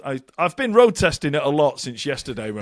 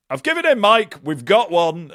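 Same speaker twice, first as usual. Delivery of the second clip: excited; 5 semitones higher, 7 dB louder than usual. Retold or imitated. Wife's excited announcement quoted.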